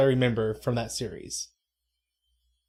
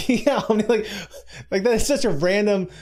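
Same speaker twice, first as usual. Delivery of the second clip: high, laughing incredulity; amused disbelief; 7 semitones higher, 4 dB louder than usual. Real, not acted. Friend reacting to an absurd definition, laughing and incredulous. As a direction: talk through a laugh, pitch climbing.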